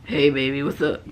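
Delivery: low, put-on morning voice flirting with a future guy; hammed-up deep sweet talk; mock deep flirt. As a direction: play the smooth low-voiced charmer. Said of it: "revealing my morning voice".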